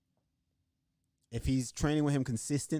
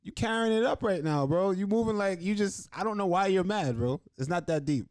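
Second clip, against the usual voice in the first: higher and a bit louder, laid-back ribbing; imitating Ortega; 8 semitones higher, 3 dB louder than usual. Fighter teasing his angry rival, high and loose. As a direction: tease him for getting so worked up. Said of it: "And he's just like".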